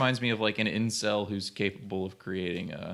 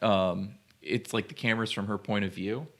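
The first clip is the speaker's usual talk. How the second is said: usual speaking voice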